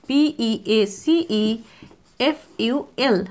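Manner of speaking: letters spelled out slowly and evenly; spelling slowly. Shown as a demonstration. Teacher spelling peaceful for students, slow and clear. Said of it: "spelling peaceful".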